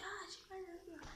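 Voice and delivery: put-on high childish whimper of pain, mild; mock pained cry. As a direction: replay the hurt four-year-old crying out. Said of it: "j'ai crié".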